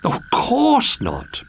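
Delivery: high, slow, beaming reply. Acted. Princess reassuring the frog, high and beaming. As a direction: beam it out sweetly, slow. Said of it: "beamed Primula".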